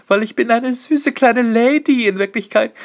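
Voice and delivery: high, slow, sweet mock lady voice; cute little lady. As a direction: play the sweet little lady, tongue in cheek. (gespielt süße, hohe Ladystimme)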